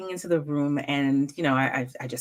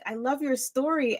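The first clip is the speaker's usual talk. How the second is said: put-on little girl; 10 semitones higher, 4 dB quieter than usual. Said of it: "she was like".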